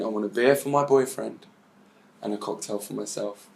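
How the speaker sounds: murmured under the breath; saying it over in her head, fading away; soft inner voice that trails off; quiet, to herself. Retold or imitated. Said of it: "now she's in her head, she's saying".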